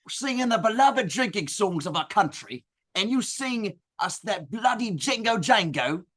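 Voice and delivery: put-on light Australian accent, picking a fight; a hint of a surly Aussie bloke. Aussie drinkers confront the brothers.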